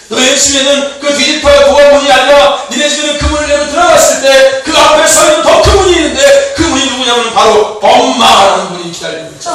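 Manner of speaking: loud, driving sermon delivery; full-force preacher calling out; forceful preaching. Acted. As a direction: preach it out to the whole room. Preaching to the congregation.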